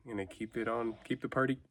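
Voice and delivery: hiccuping. Real, not acted. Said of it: "I don't know why I'm hiccuping so much right now".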